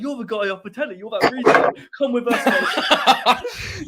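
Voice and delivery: loud, high excited greeting; imitated squaddie calling out in delight; retold rowdy soldiers. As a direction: hail him boisterously, stretching the end. Retold soldiers recognising him, drinking.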